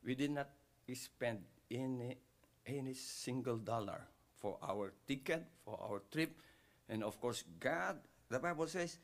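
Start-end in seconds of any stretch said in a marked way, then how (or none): none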